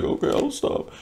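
mock-meek, voiceless murmur; hushed, submissive little reply; meek giving-in. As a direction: give in very softly, imitating her. Acting her meek giving-in.

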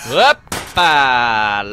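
sudden loud surprised yelp; startled shout. Real, not acted. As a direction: blurt it out startled. Startled exclamation.

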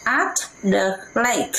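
slow, careful title reading. Shown as a demonstration. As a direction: read it out slowly for kids to hear. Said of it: "bài có tựa đề là Unit".

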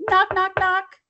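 high loud knock knock knock; knocking sound. Acted. Storyteller voicing a knock at the door, high and loud. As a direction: rap out the knocks brightly.

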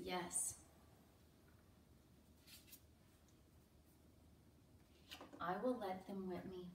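low-key, subdued consent; gentle, resigned-sounding yes; calm acceptance. Acted. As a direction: give in quietly and calmly. Submissive answer against loud refusal.

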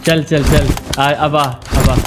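ordinary talk, nothing marked

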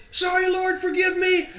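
very high, loud shouted apology; yelling back. Retold or imitated. Runner calls to the Lord mid-stride, yelling back. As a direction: shout it out without stopping.

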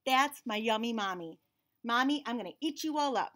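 put-on lively storybook reading in a child's narrating voice; picture book read-aloud. A narrator's reading. Reading a picture book to children, lively and bright. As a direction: read it like the kid telling the story.

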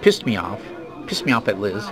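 ordinary talk

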